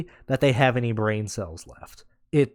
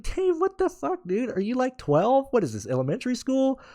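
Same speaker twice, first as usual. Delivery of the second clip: exasperated outburst; 8 semitones higher than usual. Retold or imitated. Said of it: "And I'm like".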